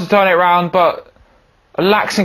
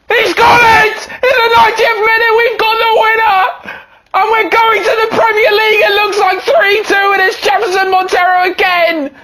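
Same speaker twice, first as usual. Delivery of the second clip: much higher, screaming commentary; ecstatic goal shout; 11 semitones higher than usual. Real, not acted. Manager celebrating the late promotion winner, screaming with joy. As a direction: lose it completely over the winner. Last-minute winning goal scored.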